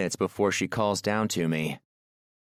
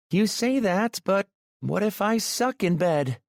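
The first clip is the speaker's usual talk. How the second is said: much higher, playful worried woman; teasing woman's voice; 12 semitones higher than usual. A narrator's reading. Aurora jokes with Maxim about being bad in bed, high and playful. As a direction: tease him in a high voice.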